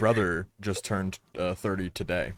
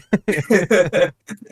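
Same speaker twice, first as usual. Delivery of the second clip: high loud burst of laughter; 9 semitones higher, 7 dB louder than usual. Real, not acted. Laughing at his own joke about Joker.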